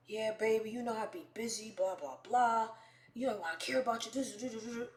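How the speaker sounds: imitating his brush-off patter; smooth-talking boyfriend. Guy placating her with excuses, smooth. Imitates the man's sweet talk.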